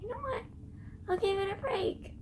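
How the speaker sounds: high, soft squeaky thought voice; mock inner voice. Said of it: "And I thought".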